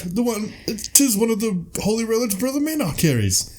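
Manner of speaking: high, solemn presenting voice; lightly voiced monk presenting a relic; reverent announcer. Presenting the holy hand grenade to the knights, high solemn voice. Quoting the film's relic line.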